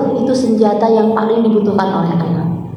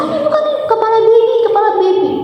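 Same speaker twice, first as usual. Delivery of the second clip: panicked nurse; 11 semitones higher than usual. Retold or imitated. Nurse panics, baby's head.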